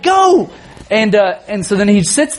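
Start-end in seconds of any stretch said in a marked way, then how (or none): 0.0-0.5 high-pitched urgent command; exasperated dad. Retold or imitated. Dad sending his son to the toilet, exasperated. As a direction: act out a dad urging his kid along.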